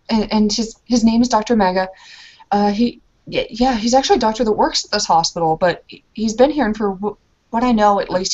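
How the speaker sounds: ordinary talk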